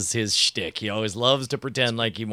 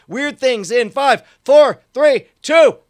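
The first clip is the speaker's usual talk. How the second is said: high, loud building countdown; rising countdown; 11 semitones higher, 11 dB louder, slower than usual. Acted. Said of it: "countdown to start".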